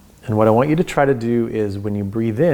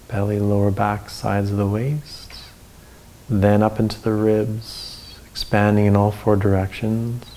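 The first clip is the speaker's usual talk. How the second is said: guided breathing. Acted.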